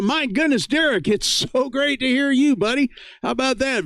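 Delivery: overjoyed to hear a buddy; excited. Real, not acted. Greeting an old friend on air.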